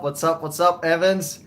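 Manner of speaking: ordinary talk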